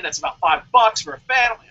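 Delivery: ordinary talk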